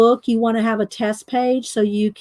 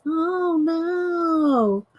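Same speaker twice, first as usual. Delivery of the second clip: higher, nasal whine of a nerdy hypochondriac; cousin Gerard; 7 semitones higher than usual. Retold or imitated. Host recalls the sitcom cousin, whiny nasal imitation. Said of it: "He talked real nasally".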